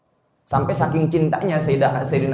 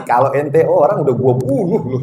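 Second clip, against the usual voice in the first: louder, half-joking threat; acted menace with raised volume; jealous mock threat; 5 dB louder than usual. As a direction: play a jealous threat for laughs. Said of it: "Saking cemburunya".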